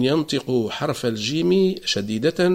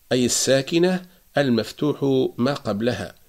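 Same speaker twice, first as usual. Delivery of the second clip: ordinary talk, nothing marked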